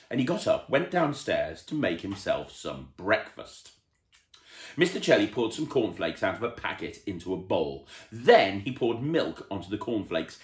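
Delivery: ordinary delivery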